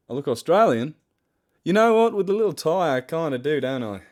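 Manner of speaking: loud, hammed-up Australian accent; big mock-Australian voice, starting high and falling; put-on Australian accent. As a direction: belt it out in a playful Australian accent. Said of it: "I don't think my Australian accent's very good".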